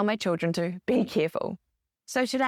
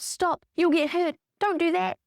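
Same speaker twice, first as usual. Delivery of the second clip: scolding parent; 8 semitones higher than usual. Retold or imitated. Parents to a child growing up, mock scolding. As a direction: voice a parent's warning, pitched up. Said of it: "if you grew up being told".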